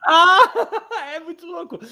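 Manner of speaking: high, bursting laugh. Real, not acted. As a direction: laugh out the words in surprise. Reacting to backwards talk.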